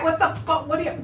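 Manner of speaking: shrill-free high mock surprise; mock shocked bankers. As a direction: play the bankers' disbelief. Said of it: "They're like".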